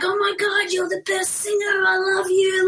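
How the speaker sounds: high-pitched imitation of an adoring fan; gushing fan. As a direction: gush like a smitten fan meeting an idol. Retold adoring fan hugging singer.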